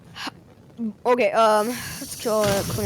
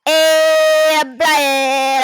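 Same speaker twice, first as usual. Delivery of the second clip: louder, thrilled chant; excited sing-song; 6 semitones higher, 9 dB louder, much slower than usual. Real, not acted. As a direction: call it out joyfully. Repeats while flying in game.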